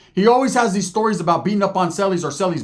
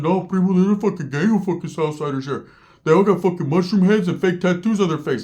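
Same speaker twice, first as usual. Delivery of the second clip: lightly voiced, near his own pitch; Ricky's real voice. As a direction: say it like the real guy, no cartoon. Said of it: "He really talks like".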